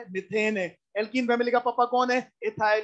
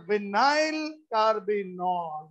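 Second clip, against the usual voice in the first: drawn-out, syllable by syllable; slow dictation; 2 semitones lower, much slower than usual. Real, not acted. Teacher writes out the compound name for students, slow dictation. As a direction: stretch the name out slowly for note-takers.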